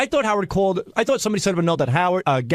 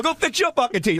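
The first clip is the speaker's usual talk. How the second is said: mock insult; 7 semitones higher than usual. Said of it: "I go".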